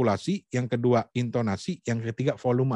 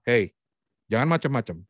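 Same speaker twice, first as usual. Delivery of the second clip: restrained angry warning. Shown as a demonstration. Coach shows how an angry person would really warn someone, firm and restrained. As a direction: press the words down as a stern warning. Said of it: "Tapi mungkin dia akan bilang".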